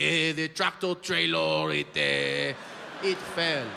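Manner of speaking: farmer now in a hammed-up French accent; mock French accent. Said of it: "is now like".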